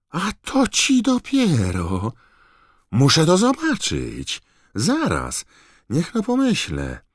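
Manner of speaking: high, slow, delighted curiosity; eager to see the gadget; intrigued. Acted. Mr Weasley thrilled by the electric plug, high intrigued voice. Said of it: "wyraźnie zaintrygowany".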